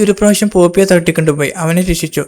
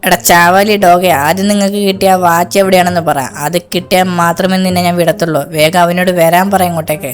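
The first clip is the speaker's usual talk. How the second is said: a gang boss threatening his captive, voice changed from own; menacing cartoon villain, leaning in.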